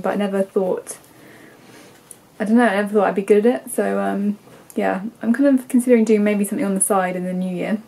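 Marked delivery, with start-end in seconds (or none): none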